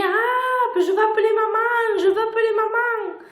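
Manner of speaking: imitating a panicked little sister; panicked child. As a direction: mimic a nine-year-old crying out in panic. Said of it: "ma sœur hurlait".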